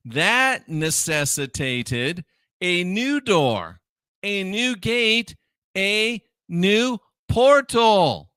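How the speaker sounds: deliberate, spaced-out words, rising to a loud finish; weighty, drawn-out preaching list; slow emphatic build. Real, not acted. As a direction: build slowly to a punched last item.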